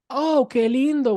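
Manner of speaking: put-on Oh, qué lindo in Spanish accent, high and slow; cooing Oh, qué lindo with Spanish sounds; Spanish phrase. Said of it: "and she goes".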